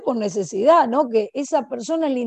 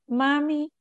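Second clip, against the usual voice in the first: put-on higher little-kid "mami" calls; higher-pitched, repeated child's call; grandson calling mom; 3 semitones higher, slower than usual. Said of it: "mi nieto empieza a decir".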